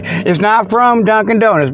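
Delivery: normal talking voice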